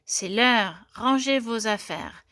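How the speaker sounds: usual speaking voice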